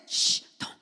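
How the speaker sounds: whispered shush imitating the kids; breath-only warning, as retold; hushed shushing. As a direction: hush him quickly under your breath. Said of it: "the kids are telling him".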